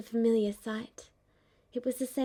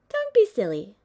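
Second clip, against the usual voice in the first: louder, playful scolding; 10 dB louder than usual. Acted. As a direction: swat him back playfully.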